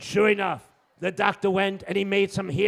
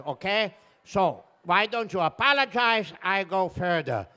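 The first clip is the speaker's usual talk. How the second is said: lightly voiced puzzled driver; accented bus driver. Bus driver arguing right of way, mildly put-out. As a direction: reason stubbornly in lightly accented English.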